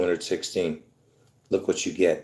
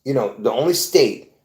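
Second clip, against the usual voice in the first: ordinary talk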